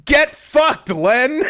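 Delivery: high, loud punchline breaking into laughter; gleeful imitation of a heckling comic; mock taunting shout. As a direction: yell the comedian's jab while cracking up. Retelling comedian's punchline, cracking up.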